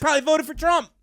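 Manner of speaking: high mocking baby-talk voice. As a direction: coo it mockingly.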